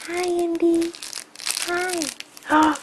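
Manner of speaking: toy bear voice. Pretend.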